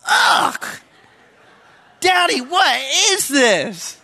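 put-on high, loud child's yuck, then slow complaint; disgusted little girl. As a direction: play the daughter spitting out marinara toast. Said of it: "I hear her go".

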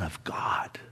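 whispered awe. Real, not acted. Hushed climax.

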